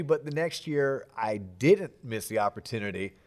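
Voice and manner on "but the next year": ordinary talk, nothing marked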